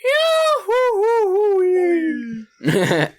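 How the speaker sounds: shrieking high-pitched cackle; high maniacal laugh. Acted. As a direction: let out a wild high laugh. DM laughs in character.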